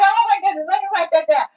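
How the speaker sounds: mock excited realization; excited thought. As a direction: act out a sudden brainstorm. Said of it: "you're like".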